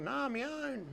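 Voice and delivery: high, pitiful voice of the bandaged fella; whiny injured stranger. Retold or imitated. Bandaged fella telling Rod what happened, high whine. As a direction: moan it like a hurt, sorry bloke.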